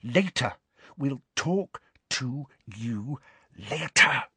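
slow, word-by-word, high and strained; slow, over-articulated signalling; exaggerated mouthing. A narrator's reading. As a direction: mouth each word separately across a room. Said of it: "she mouthed to Ernie and Hannah".

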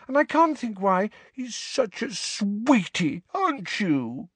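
high, cooing nurse voice; motherly healer. Acted. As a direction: coo over him like a toddler. Healer character voice.

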